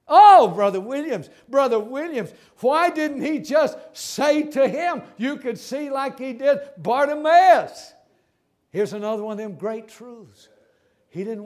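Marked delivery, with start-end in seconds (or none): 0.1-7.9 loud, high objection; hammed-up, exasperated questioning; acted protest. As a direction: plead with the preacher in mock complaint. Preacher voices an objecting listener.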